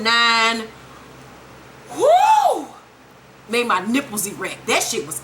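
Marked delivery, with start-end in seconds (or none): 1.9-2.7 very high loud whoo; thrilled falsetto whoo; excited whoo. Real, not acted. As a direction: hype yell. Hyped about the ending.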